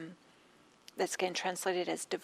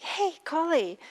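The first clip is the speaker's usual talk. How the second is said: high, louder fed-up call; exasperated; 9 semitones higher, 6 dB louder than usual. Retold or imitated. Mistress calling her maid Kali, exasperated. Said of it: "this exasperation".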